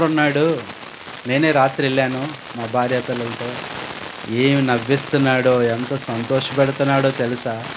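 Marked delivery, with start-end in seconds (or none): none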